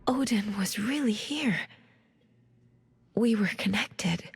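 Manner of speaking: hushed realisation to herself; awed whisper. Acted. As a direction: whisper it like a discovery. Inner monologue after vision.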